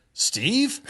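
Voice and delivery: a friend suddenly recognizing you; the jumpmaster suddenly realizing who landed in his drop zone, surprised; surprised recognition. Retold or imitated.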